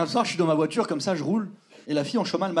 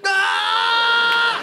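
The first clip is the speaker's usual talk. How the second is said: startled cry; 11 semitones higher, 3 dB louder than usual. Retold or imitated. Startled in front of possessed girl.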